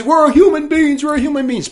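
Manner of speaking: imitating refugees yelling; mock yell. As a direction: shout it like a protester. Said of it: "They're yelling at them".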